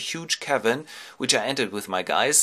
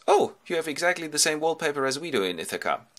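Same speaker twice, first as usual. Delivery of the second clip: high-pitched lad's remark; boy's exclamation; 7 semitones higher than usual. Acted. Telemachos admires the host's house, high boyish voice. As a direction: pipe up like a visiting boy. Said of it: "And he goes".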